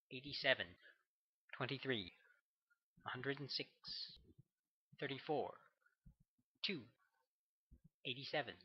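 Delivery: flat, even number-reading, one digit at a time; mechanical accented woman. A narrator's reading. Radio numbers station intoning to the listener, mechanical and accented. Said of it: "accented female voice mechanically intoning".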